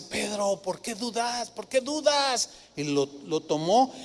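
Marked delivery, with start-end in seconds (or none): 0.0-2.5 soft, tender, half-sung question; Jesus softly chiding Peter as he sinks; gentle rebuke. Retold or imitated. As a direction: gently reproach a frightened friend. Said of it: "le dice".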